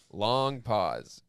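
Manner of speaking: usual speaking voice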